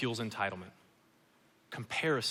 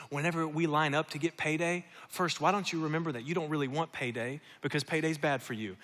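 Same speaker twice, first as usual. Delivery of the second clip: ordinary talk, nothing marked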